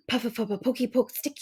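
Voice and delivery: plain reading voice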